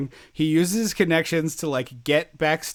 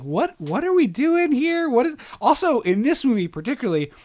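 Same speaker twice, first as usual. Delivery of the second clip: high-pitched, incredulous questioning; exasperated disbelief; 10 semitones higher, 4 dB louder than usual. Acted. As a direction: voice leaps up in baffled protest. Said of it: "it's just like".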